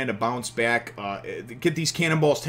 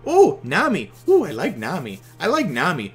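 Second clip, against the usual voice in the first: put-on high, sing-song little-kid voice; imitating a toddler; 7 semitones higher, 4 dB louder than usual. Dad imitating his toddler son naming favorite characters, high childish voice. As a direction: chirp it like a two-year-old. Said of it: "my two and a half year old".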